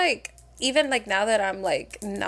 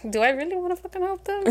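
sarcastic; 8 semitones higher than usual. Acted. Sarcastic aside about callers.

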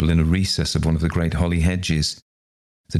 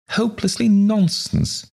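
high, incredulous dismissal; acted indignant scoff; scoffing disbelief. A narrator's reading. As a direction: echo it high, then brush it aside. Visitor scoffs at the objection.

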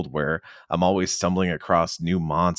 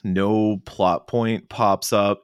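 usual speaking voice

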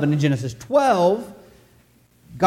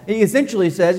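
ordinary delivery

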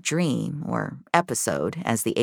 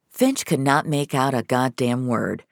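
plain reading voice

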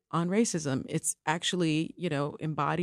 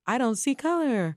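higher, mock-airy flippancy; flippant imitation; 7 semitones higher than usual. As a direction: imitate a breezy brush-off, pitched up. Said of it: "It's kind of flippant".

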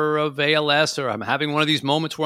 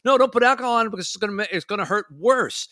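higher, rushed protest, lightly voiced child; protesting kid; 7 semitones higher, faster than usual. Kid objecting to alcohol on a wound, lightly voiced protest. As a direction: plead in a hint of a kid's voice. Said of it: "like a kid".

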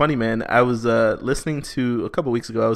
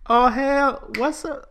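high, sassy greeting; 12 semitones higher, much slower than usual. Shown as a demonstration. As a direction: toss the greeting with sass. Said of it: "practicing your sass".

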